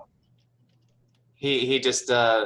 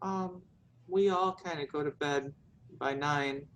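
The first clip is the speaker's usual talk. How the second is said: put-on higher, soft girl's voice; meek daughter; 5 semitones higher, 7 dB quieter than usual. Daughter answers visitor's question, meekly. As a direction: answer timidly, pitched up and softer.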